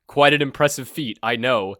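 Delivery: grandiose villain. Acted. Villain gloats to his blindfolded captive, pompous and loud. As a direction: boom out a self-important evil plan.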